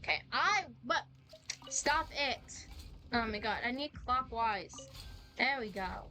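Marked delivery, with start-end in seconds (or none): none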